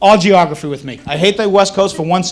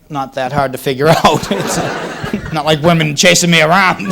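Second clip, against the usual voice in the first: ordinary talk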